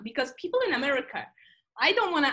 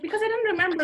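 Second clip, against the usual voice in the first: 1 ditzy cashier; 8 semitones higher, 3 dB louder, faster than usual. Retold or imitated. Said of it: "she's like".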